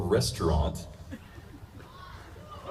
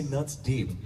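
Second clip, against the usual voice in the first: easy, unbothered delivery; casual, matter-of-fact; 4 semitones higher, 3 dB quieter than usual. Retold or imitated. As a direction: say it like it's nothing. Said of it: "they can just say".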